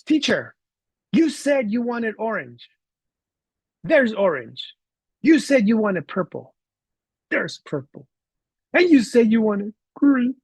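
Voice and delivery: put-on high, slow, deliberate kid voice; articulate child. Boy showing teacher his colors, high and deliberately articulate. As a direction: imitate a child proving a point item by item. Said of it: "he was very articulate".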